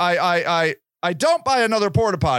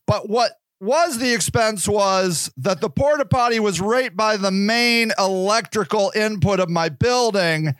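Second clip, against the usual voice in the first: usual speaking voice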